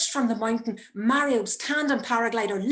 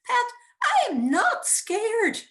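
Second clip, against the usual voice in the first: much higher, louder, slow strangled falsetto; 7 dB louder, slower than usual. Retold or imitated. Mother reassuring her son while terrified, strangled falsetto. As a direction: squeeze the fear out in a false high voice. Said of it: "my voice strangled falsetto".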